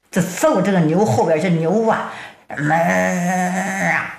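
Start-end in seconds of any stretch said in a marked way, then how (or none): 2.5-4.2 long drawn-out cow moo; low, slow lowing of an ox. Pretend. The ox strains at the gate and lets out a long moo.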